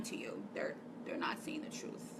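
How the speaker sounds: breathy whispered aside. Real, not acted. Confiding to followers that liars deceive them, whispered.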